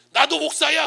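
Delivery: high, loud acted outburst; imitated forceful declaration; blurted retort. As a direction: fire off one indignant line as the other pastor. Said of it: "그분이 한마디를 탁 터요".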